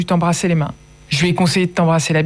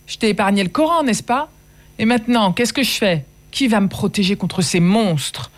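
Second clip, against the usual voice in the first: talking loud; 6 semitones higher than usual. Acted. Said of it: "Je lui parlais fort".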